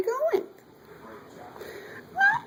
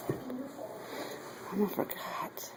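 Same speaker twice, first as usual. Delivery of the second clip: whispering; 4 semitones lower, 15 dB quieter, much slower than usual. Real, not acted. Mom whispering to herself in dark.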